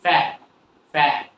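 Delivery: "ফ্যাট" with tongue stuck to the palate, T not released; unreleased T. Shown as a demonstration. Teacher shows tongue on palate in "ফ্যাট", T silent. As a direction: clip "ফ্যাট" before the T sounds. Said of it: "তালুর সাথে যে লেগে যাবে".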